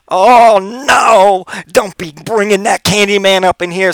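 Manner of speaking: put-on high, loud sassy older lady voice. Older lady warning him not to mention Candyman, high and loud. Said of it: "And the lady's like".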